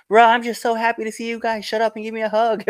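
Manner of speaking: loud, high-pitched happy outburst; overjoyed greeting. Retold or imitated. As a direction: burst out, thrilled to see old friends. Retold joyful greeting to friends.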